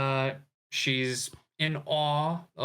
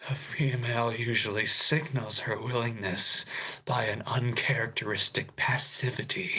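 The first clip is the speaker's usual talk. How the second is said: Discord mod voice. Acted. Host reading Peter's spider courtship lines, whispered Discord mod voice. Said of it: "I'm gonna do this in my Discord mod voice".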